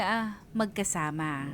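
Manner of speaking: normal talking voice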